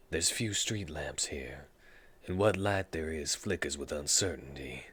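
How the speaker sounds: low, soft, hushed storyteller read; scene narration. Acted. Reading the game's scene text to viewers, low hushed narration. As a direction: set the grim scene softly, like a narrator.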